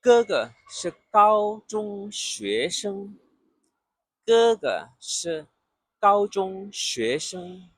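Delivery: Ge ge shi gao zhong xue sheng said slowly, tones clear; slow model sentence. Shown as a demonstration. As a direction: model the line slowly for learners. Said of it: "joins sounds and tones".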